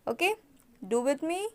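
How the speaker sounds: ordinary delivery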